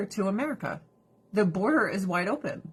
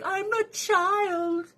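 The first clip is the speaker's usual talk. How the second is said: put-on much higher, slow little-girl voice; imitating child bride; 10 semitones higher, slower than usual. Child bride voiced by narrator, high slow small voice. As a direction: speak slowly like a small resigned girl. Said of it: "And she's like".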